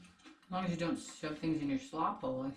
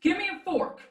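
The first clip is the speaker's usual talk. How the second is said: imitating him; 12 semitones higher, 7 dB louder than usual. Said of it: "he'll be like".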